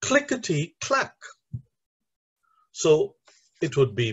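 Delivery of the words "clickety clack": CLICK-ety-CLACK, stressed at both ends; Clickety-clack, high, with first and fourth syllables punched; stress first and last syllable. Shown as a demonstration. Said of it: "the first syllable and the fourth syllable are stressed".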